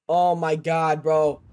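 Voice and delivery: ordinary talk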